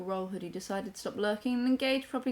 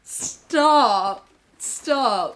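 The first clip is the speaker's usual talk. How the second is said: mock protest; 3 semitones higher, 11 dB louder than usual. Coyly protesting a compliment.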